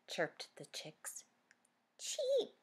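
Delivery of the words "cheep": tiny high cheep; Pip's squeaky cheep. Acted. Little Pip copying the chicks, squeaky. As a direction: chirp it way up high. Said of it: "said Pip".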